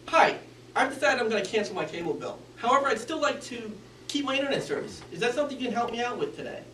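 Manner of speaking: fast, mumbled, rushed through; hurried mumbling request. Shown as a demonstration. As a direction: speed through it without care. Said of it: "he mumbled and he sped through".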